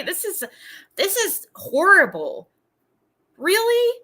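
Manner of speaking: a shocked cry of disbelief; outraged disbelief. Real, not acted. Reacting to hateful messages.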